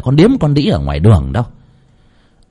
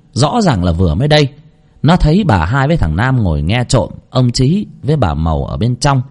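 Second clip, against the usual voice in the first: ordinary delivery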